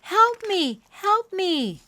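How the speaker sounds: very high, loud cry; yelling for help. A narrator's reading. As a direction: scream for help. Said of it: "she yelled".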